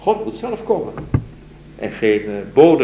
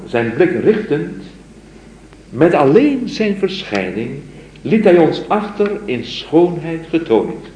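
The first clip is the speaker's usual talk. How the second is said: raised, slow solemn recitation; poem recital; 7 semitones higher, 5 dB louder, slower than usual. Acted. Lecturer reciting the mystic's poem to his students, solemn recital. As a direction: recite it like a devoted lover's plea.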